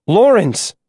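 high, insistent call with a rise and fall; urgent call. A narrator's reading. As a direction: call out harder to stop him.

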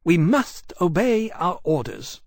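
slow, self-righteous pronouncement; smug virtue. A narrator's reading. Iskiaka justifying the raid to the other dragons, smugly. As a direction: say it sure you are in the right. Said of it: "with an air of smug virtue".

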